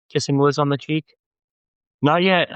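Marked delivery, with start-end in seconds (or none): 2.0-2.5 huffy. Acted. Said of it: "Morgan huffed".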